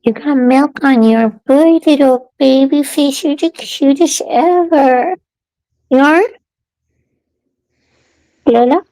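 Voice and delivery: high sing-song pet voice. Real, not acted. Cooing to her cat.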